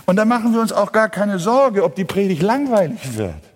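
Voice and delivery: high, quick preaching burst; forceful preaching. Real, not acted. Preacher dismisses worry about boring sermons, forceful preaching.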